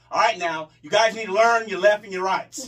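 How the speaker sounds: loud, barking at recruits; angry drill instructor. Retold or imitated. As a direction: bark it like a mad drum major. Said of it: "mad as fuck".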